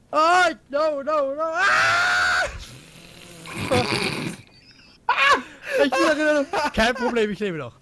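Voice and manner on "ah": sudden short shout; startled shout. Real, not acted. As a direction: yelp when hit. Shot in the game. (kurzer Schreckensschrei)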